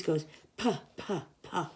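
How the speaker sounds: soft puffs of puh; breathy puh sounds. Shown as a demonstration. Teaching kids the P sound, soft puffs. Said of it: "The sound of a P is".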